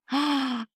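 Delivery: sudden audible gasp; gasp of realization. Retold or imitated. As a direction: gasp as the idea hits you. Acts out her sudden realization.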